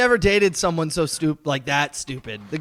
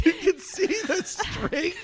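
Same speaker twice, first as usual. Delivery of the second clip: slow, high, laughing repeat; laughing mock; 10 semitones higher, 5 dB quieter, slower than usual. Mocking the moon-landing doubter, laughing and slow. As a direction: savour the absurd line, cracking up.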